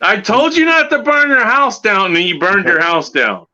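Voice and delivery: high, incredulous scolding; exasperated mock scolding. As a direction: scold like a fed-up mentor. Exasperated rant at player.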